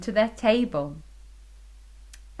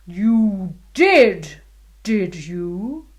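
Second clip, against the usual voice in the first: bellowing; 3 semitones lower, 6 dB louder, much slower than usual. Acted. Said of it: "bellowed the king".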